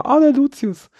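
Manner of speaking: put-on high excited little kid voice; child's voice. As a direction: squeal it like a small child. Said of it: "die Rosa sagt jedes Mal".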